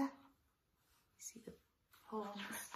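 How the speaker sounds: hushed aside; whispered aside. Real, not acted. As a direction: point it out in a whisper. Parent aside to child.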